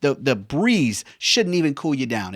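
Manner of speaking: normal talking voice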